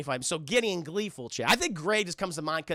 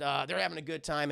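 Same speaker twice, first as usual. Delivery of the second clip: usual speaking voice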